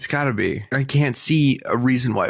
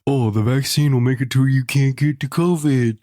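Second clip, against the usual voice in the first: loud, high sing-song mock voice; sarcastic imitation of vaccine believers; mocking naive people; 4 semitones higher, 4 dB louder than usual. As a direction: parody the know-it-alls. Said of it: "all those people who are like".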